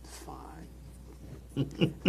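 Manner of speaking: whispered curt fine; flat whisper. Retold or imitated. Asked about her day, whispered curt reply. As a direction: breathe it out, unimpressed. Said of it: "I was like".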